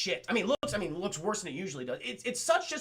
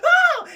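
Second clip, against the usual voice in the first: high mock scream; 15 dB louder than usual. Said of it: "She's like".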